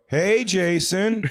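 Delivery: high, slow sing-out hello; teasing greeting. Retold or imitated. Sting crew calling to him at a car dealership, mock-friendly. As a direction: draw it out in a mock-friendly call.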